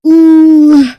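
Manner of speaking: loud high ooh; loud cringing ooh; disgusted ooh. Real, not acted. Reacting to older boyfriend.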